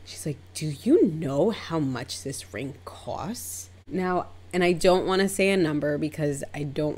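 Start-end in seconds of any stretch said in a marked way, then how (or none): 0.5-3.7 slow, pointed challenge; drawn-out indignant question; insulted indignation. Retold or imitated. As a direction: imitate an affronted client, stretching the words. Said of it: "insulted that that's my reaction".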